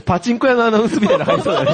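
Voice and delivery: laughing. Real, not acted.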